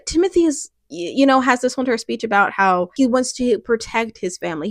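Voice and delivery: ordinary delivery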